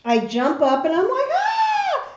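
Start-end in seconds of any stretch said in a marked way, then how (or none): 1.3-2.1 very high, held imitation scream; high-pitched scream at normal volume; retold scream. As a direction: re-enact waking up scalded with a shriek. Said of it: "I'm screaming like".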